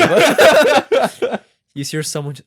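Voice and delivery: laughing. Real, not acted. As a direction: talk while cracking up. Laughs while asking.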